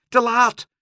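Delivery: very high, loud shriek; high scream of protest. A narrator's reading. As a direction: shout it out at top pitch. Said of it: "schreeuwde Dolly".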